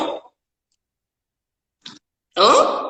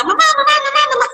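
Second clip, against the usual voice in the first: put-on high, loud child calling mamá over and over; a child tugging at mum, much higher and louder; nagging child; 3 dB louder, much faster than usual. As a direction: pester like an impatient little kid. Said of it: "que mamá está hablando".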